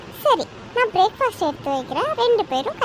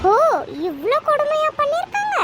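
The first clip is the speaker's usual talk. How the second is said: sarcastic mock shock; 8 semitones higher than usual. Daughter-in-law mocks the mother's complaint.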